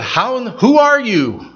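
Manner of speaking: high, mock-indignant question; indignant stranger. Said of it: "And he said".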